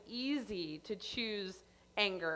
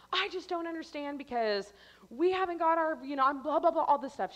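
higher-pitched retold complaint; frustrated; 6 semitones higher, 3 dB louder than usual. Caller complaining to airline about a refund, frustrated. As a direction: plead your case in exasperation, then blah it away. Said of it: "I'm getting so frustrated".

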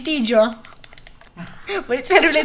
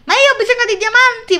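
whiny plea; 7 semitones higher, 5 dB louder, faster than usual. Pretend. Whining for diamonds in game.

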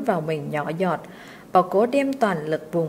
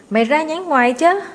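loud, much higher call up into the tree; shouting up; 7 semitones higher, 6 dB louder than usual. A narrator's reading. Boy below yelling up to the servant in the tree. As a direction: yell up at him from below. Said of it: "Thằng Quyên đứng dưới hét lên".

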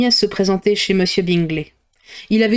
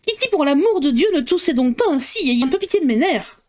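higher, nagging scold; 7 semitones higher than usual. A narrator's reading. Mother scolding Kitty for coughing, higher nagging. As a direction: complain about her nerves while scolding. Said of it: "elle se mit à gourmander".

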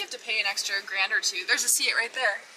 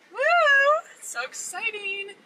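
excited; 12 semitones higher, 6 dB louder than usual. Real, not acted. Arriving at the museum.

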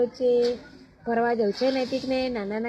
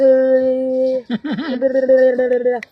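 loud laughing trill; playful trilled babble; 9 dB louder than usual. Real, not acted. Adult amusing a baby, loud playful trill. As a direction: burst into a rolling silly noise. Playing noises to amuse the baby.

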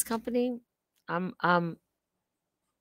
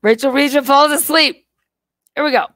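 loud, higher showman call-out; mock announcement; 6 semitones higher, 12 dB louder than usual. Announcing her own sleeping clip to viewers, mock loud fanfare. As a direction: announce it like a game show host.

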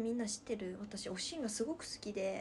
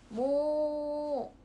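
キリンの鳴き真似; 3 semitones higher, 6 dB louder than usual. Pretend. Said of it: "ってキリンが言うと".

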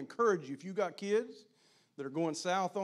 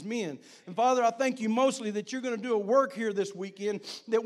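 fervent prayer, rising louder; closing prayer; 4 semitones higher, 6 dB louder than usual. Acted. Preacher praying over the men, fervent and rising. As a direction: pray over the men with growing fervour.

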